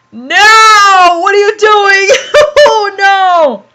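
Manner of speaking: loud, high wail of disbelief; shocked laughing outburst. Real, not acted. Reader appalled by the fanfic's wording, loud high amused protest. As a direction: cry out aghast while breaking into laughter.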